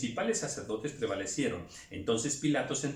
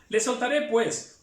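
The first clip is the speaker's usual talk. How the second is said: higher, louder announcement; raised proclamation; 9 semitones higher, 6 dB louder, slower than usual. Acted. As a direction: call it out to the crowd. Pilate offers release. (Pilato anuncia en voz alta)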